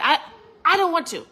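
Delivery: high, loud pouting no; bratty refusal. Retold or imitated. Refusing her husband, a high loud bratty whine. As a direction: whine it like a stubborn child.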